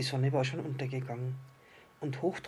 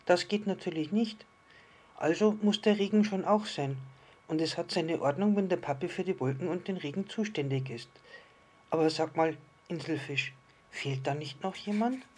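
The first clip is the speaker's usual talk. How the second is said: higher, emphatic little-star voice; decided little star; 5 semitones higher, 4 dB louder than usual. Acted. Little star agrees rain must be, then asks, higher and emphatic. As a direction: reason it out as a small storybook star.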